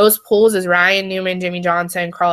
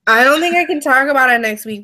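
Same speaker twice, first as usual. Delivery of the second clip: higher, louder and quicker; raised, hurried protest; emphatic insistence; 5 semitones higher, 5 dB louder, faster than usual. Real, not acted. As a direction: cut back in and insist. Repeats it emphatically after being cut off.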